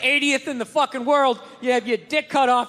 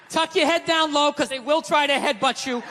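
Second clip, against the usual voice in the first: ordinary delivery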